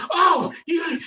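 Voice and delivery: put-on high shrieking drunk woman. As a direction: shriek it out indignantly.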